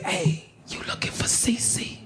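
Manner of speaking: hushed, furtive question; secretive stage whisper; covert approach. Retold or imitated. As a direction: whisper it like a shady offer. Inmate approaches him covertly.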